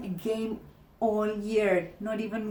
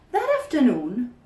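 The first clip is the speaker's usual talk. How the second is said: usual speaking voice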